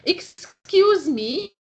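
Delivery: polite complaint opener. Shown as a demonstration. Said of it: "Here is another example".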